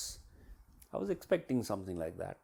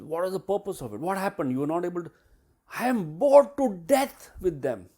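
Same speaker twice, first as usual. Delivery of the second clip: high-pitched, indignant mock outburst; livid; 7 semitones higher, 7 dB louder than usual. Player challenging coach on the pointless exercise, livid. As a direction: imitate a player exploding at her coach.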